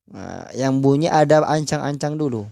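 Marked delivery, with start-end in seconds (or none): none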